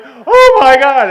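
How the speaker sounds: loud, high, slow wail; hammed-up sobbing cry. Newcomer breaking down on the steps, hammed-up sobbing cry. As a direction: wail it through mock sobs. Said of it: "and I'm sobbing".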